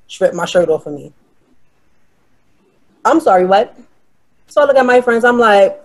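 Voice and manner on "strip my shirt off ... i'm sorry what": ordinary delivery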